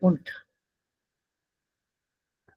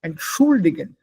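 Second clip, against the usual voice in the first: Entschuldigen with SCHUL stressed; light ENT, strong SCHUL in Entschuldigen; stress on second syllable. Shown as a demonstration. Teacher giving correct Entschuldigen, stress on SCHUL. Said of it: "That's not entschuldigen".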